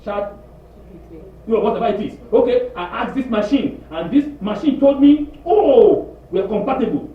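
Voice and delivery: high, loud excited insisting; mock excited daughter; insistent daughter. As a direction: defend the boyfriend eagerly. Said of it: "She said".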